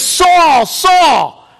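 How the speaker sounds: high, loud accusing call of a name; a grieving brother shouting at Saul; angry brother Seneca. Acted. Seneca calls out to Saul, high and accusing.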